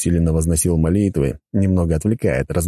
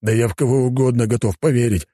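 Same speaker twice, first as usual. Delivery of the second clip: mild, higher-pitched outburst; desperate exclamation; 7 semitones higher than usual. A narrator's reading. Inspector ready to believe anyone, mild desperate exclamation. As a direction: blurt it, pitched up. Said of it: "воскликнул инспектор".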